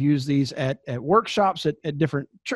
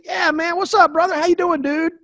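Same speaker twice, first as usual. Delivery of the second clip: high, bright over-the-top pal greeting, much higher; fake buddy greeting; 11 semitones higher, 3 dB louder than usual. Covering for a forgotten name with a much higher chummy greeting. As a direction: gush like you know them well. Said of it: "what I'll say is".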